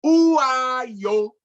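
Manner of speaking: high, loud ringing call to the warriors; a young leader's first loud command. Acted. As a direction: call the group to attention with full voice. Called for attention.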